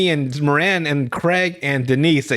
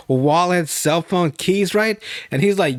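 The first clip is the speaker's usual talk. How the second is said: normal talking voice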